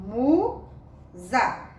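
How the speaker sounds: MUSA sounded as MU, pause, ZA; teacher sounding out MUSA; syllable by syllable. Shown as a demonstration. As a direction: stretch MU, then a separate ZA. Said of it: "Sílaba mu e a sílaba za".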